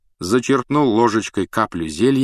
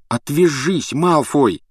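higher, louder angry snap; angry outburst; 7 semitones higher, 4 dB louder, slower than usual. Acted. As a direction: flare up at the bully. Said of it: "вскипел Рон".

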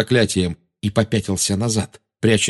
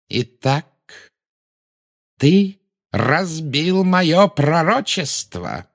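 high, slow, cold questioning; Volan-de-Mort, insinuating; 8 semitones higher, much slower than usual. A narrator's reading. Dark lord questions the frozen boy, slow and insinuating. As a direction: draw it out with silky menace. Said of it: "вкратчиво спросил Волан-де-Морт".

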